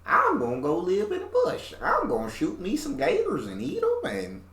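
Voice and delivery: high-pitched imitation of a country American accent; fake country accent. Imitating the video host's gator hunter, fake country accent. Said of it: "fake country American accent".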